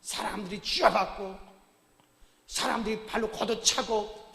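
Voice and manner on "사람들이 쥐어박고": ordinary talk, nothing marked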